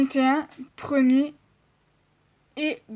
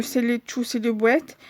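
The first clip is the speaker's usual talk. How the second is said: normal talking voice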